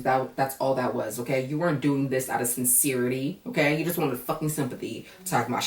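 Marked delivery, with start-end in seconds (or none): none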